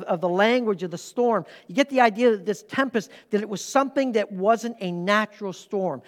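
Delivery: ordinary talk, nothing marked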